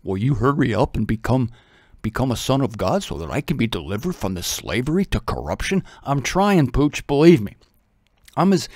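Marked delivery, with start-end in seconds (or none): 0.0-5.8 low, soft dog's inner voice; voicing the dog. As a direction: speak as a hopeful dog, lower and hushed. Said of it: "the dog is communicating this to me".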